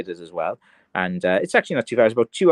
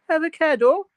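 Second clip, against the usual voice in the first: put-on very high, questioning Limerick accent; 4 dB louder than usual. Imitating the Salt Bae meme, high Limerick accent. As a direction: pitch it right up, Limerick style. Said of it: "it's in a Limerick av-, accent".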